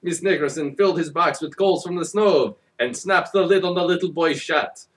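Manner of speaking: ordinary delivery, nothing marked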